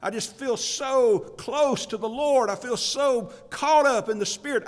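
usual speaking voice

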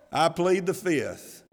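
slow, deliberate mock legal plea; mock lawyer plea. Priests dodging Jesus's question like lawyers, slow mock plea. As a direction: spell it out like a cagey lawyer. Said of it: "like any good lawyer would tell them".

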